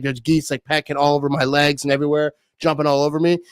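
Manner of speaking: ordinary talk, nothing marked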